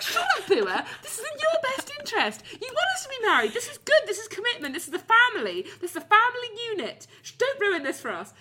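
high laughing telling-off of a dog; scolding the dog. Retold or imitated. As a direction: tell off the dog while giggling. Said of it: "I was like".

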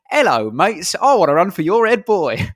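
high, loud Russell Brand voice; nervous mock Russell Brand accent; Russell Brand impression. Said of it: "I did it in someone else's accent".

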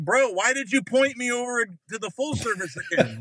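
high-pitched, baffled complaint; exasperated protest. Retold or imitated. Driver challenging attendant about full service, exasperated. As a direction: plead in comic exasperation.

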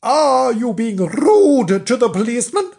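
high, loud reproving question; shocked wifely disapproval; scolding wife. Acted. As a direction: tick off your husband. Wife scolds her husband.

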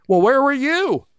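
lightly voiced Beth demanding an answer; girlfriend's voice. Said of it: "Beth was waiting for me and she said".

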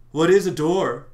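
loud, high bewildered question; baffled. Retold or imitated. The reader mocking the door line, loud and baffled. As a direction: throw up your hands at it. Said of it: "repeating the joke".

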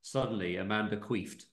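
ordinary talk, nothing marked

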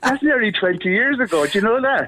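high laughing protest; amused disbelief, laughing. Real, not acted. Laughing about being older.

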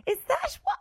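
high, loud, incredulous squeal; hammed-up panicked disbelief; mock shock. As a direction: react with exaggerated alarm, voice shooting up. Mock shock at joke about job.

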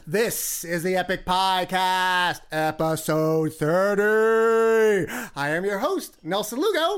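big drawn-out show announcer. Acted. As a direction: announce it like opening a live show. Host announces podcast intro.

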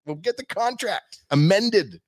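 very high, loud mock reassurance; over-eager promising. Buyer vowing to keep the logo in contract, over-eager high promise. Acted frantic promise to owner.

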